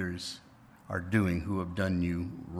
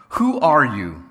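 startled cry; 4 semitones higher, 13 dB louder than usual. Acted. Frightened boy calls out.

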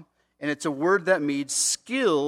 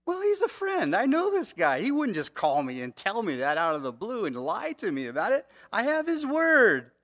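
excited insisting; 7 semitones higher, 4 dB louder than usual. Retold or imitated. Pastor insisting, voiced by preacher.

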